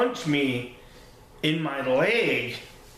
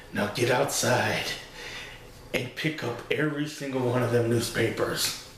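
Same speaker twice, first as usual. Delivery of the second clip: lower, softer, lightly voiced stern father; stern dad order; 4 semitones lower, 4 dB quieter than usual. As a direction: growl the order like a fed-up dad. Furious dad orders son out.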